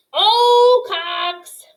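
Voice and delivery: loud high bellowing call. A narrator's reading. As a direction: bellow the name across the woods. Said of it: "he bellowed".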